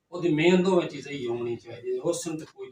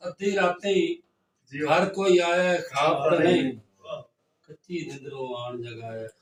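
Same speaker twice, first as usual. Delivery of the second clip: loud, raised mushaira verse delivery; poetry recitation; 7 dB louder than usual. Acted. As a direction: declaim the verse to listeners, repeating it with more force. Recites own verse to audience.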